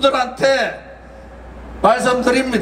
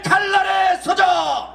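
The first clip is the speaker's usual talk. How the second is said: roaring rallying speech; 5 semitones higher than usual. Real, not acted. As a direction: roar each slogan at the crowd. Rally closing chant of slogans.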